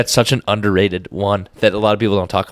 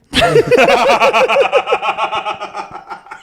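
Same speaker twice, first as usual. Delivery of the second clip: long high-pitched laugh; real high cackling laughter. Real, not acted. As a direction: laugh hard and long at the story. Group laughs at the story.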